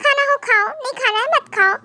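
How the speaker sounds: mocking his words. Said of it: "क्या कह रहे थे?".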